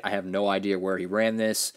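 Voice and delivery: ordinary talk, nothing marked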